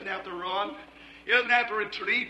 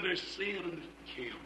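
lower, much softer "There's sin in the camp"; grave hush; 5 semitones lower, 15 dB quieter than usual. Acted. Angel pronouncing judgment on Joshua, solemn low hush.

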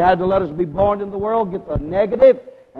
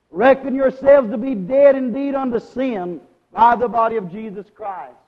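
preaching; 3 semitones higher, 5 dB louder than usual. Real, not acted. Preacher repeating Paul's command to congregation, forceful preaching. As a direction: ring the verse out over the congregation.

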